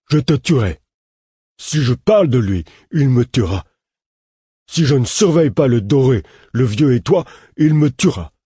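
put-on high, loud, panicked childlike voice; a scared man-child blurting out his terror; terrified simple man. A narrator's reading. Simple man to the narrator, afraid of the killer, panicked high voice. Puis se mit à respirer par saccades.

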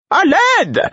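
very high, loud call for help; piercing cry for help. A narrator's reading. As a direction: shout for help in alarm. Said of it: "s'exclama Caudron".

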